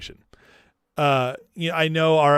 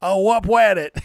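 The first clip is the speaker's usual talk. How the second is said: louder, higher Elmer Fudd-like echo; mocking impression; 5 semitones higher, 5 dB louder than usual. Host parroting the congressman's punchline, mocking impression. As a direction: mimic the clip with w for l, "pwanet".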